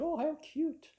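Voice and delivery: high, sweet cooing; cooing over it. Retold or imitated. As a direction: melt at something adorable. Sees dolphin picture on ukulele.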